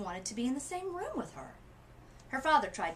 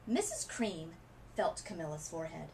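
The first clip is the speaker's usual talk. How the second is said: ordinary delivery, nothing marked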